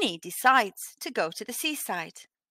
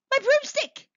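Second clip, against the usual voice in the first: high, loud alarmed cry; a witch realising her broom is gone; 4 dB louder than usual. Acted. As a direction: gasp out the discovery in a panic. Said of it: "cried Winnie".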